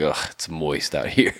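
low, slow grossed-out complaint; disgusted groan. Retold or imitated. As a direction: drag it out with disgust. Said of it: "We're like".

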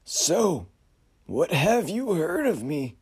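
high, slow uncanny question; otherworldly figure pressing; eerie high voice. Acted. Frederick presses.

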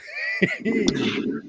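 high shrieking burst of laughter. Real, not acted. Big laugh at tent photo.

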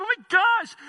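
high, loud burst of amazement; excited exclamation. Retold or imitated. Preacher relives listening to a new believer's insight, excited exclamation. Acting out his thrilled reaction.

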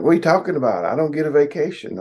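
high, whiny protest; mock indignant coworker. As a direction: mimic the coworker's offended complaint. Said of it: "And they're like".

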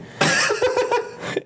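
a high, airy squeal of laughter; delighted high-pitched giggle; high squeaky giggle. Real, not acted.

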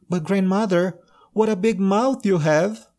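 put-on high, alarmed little girl; wide-eyed girl growing scared.

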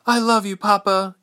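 very high, loud daughter's voice; Ilse, farewell. A narrator's reading. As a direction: answer your father in a high, urgent voice. Daughter answers father's farewell.

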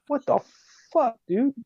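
high-pitched, baffled disbelief; imitated "are you serious" outburst; incredulous reaction. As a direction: retell the squad's appalled amazement. Retold squad reaction to his waving.

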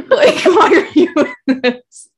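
high, loud, laughing mock protest; laughing disbelief. Film fan replaying a reaction to an actress's casting, laughing disbelief. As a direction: burst into laughter while protesting.

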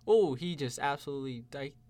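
lightly hyped play-by-play call; frantic mock sports commentary. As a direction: announce each move with mock excitement. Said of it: "I'll play-by-play now".

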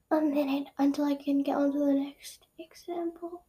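very soft, whispery aside; hushed mutter. Real, not acted. Waiting out the minute, hushed mutter. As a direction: let it sink to a near whisper. Muttering while forced to wait.

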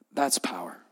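That's power dropped low and soft, a reverent hush; hushed awe. Real, not acted. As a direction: breathe it out quietly, almost to yourself. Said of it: "That's gospel power".

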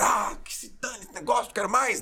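high-pitched mock outburst; acted anger. Athlete cursing the sport after losing, mock angry. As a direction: play someone throwing it all in, exasperated. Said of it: "E aí você fica bravo".

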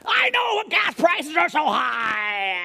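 high, loud, slow fake sob-shout; mock wailing rant. Preacher parodying an upset believer, hammed-up high wailing. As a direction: wail it like a tantrum.

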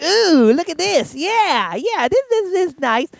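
high, lightly mocking excitement at each video; scrolling TikTok. Host mocking himself hooked on TikTok, high amused voice.

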